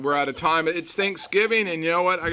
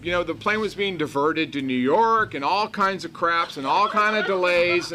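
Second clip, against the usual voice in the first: ordinary talk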